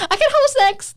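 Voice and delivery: very high bubbly service voice; customer voice up. Retold or imitated. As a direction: switch into chirpy barista mode. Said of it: "your voice goes up".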